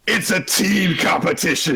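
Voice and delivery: high announcer shout; manic host. Acted. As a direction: bellow it like an overexcited host. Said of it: "if the fucking host was like that".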